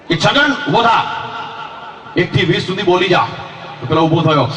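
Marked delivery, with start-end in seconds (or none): 0.2-3.3 inspector's voice. Acted.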